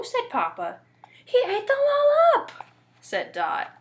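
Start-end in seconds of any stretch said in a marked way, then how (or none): 1.2-2.5 high, loud accusing cry; alarmed shout. A narrator's reading. Dot accuses the wolf, alarmed.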